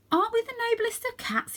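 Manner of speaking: high-pitched incredulous question; can't believe what he hears; shocked protest. A narrator's reading. Young Varjak protests to Jalal, high and disbelieving.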